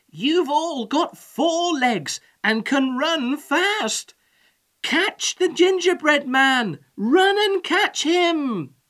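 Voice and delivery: high, loud, slow rallying call; rally the troops with long shouted words; shouting. A narrator's reading. Old man sends the pigs after the runaway, shouting. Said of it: "shouted the old man to the pigs".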